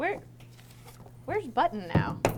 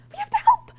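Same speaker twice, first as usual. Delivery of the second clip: louder, laughing insistence; excited, laughing; 10 dB louder than usual. Acted. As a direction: push harder, breaking into laughter. Urging again, louder, laughing.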